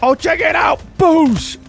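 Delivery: very high, loud gloating shout; thrilled gamer yell; excited whoop. Real, not acted. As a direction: shout it high and punch out the boosh. Celebrates a game play.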